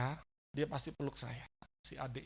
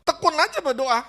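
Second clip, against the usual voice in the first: insistent loud repeat; 11 semitones higher, 15 dB louder than usual. Retold or imitated. Pastor insisting to the troubled young man, loud and firm. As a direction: push it out loud and high, insisting.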